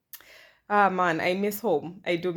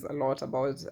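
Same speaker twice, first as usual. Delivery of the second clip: ordinary delivery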